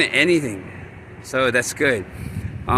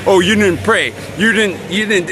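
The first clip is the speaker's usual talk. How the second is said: louder, higher nagging inner voice; nagging criticism; 4 semitones higher, 3 dB louder than usual. Retold or imitated. Inner critic faulting the speaker for not praying, mock nagging. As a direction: mimic a critic who keeps picking. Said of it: "just criticism and just like".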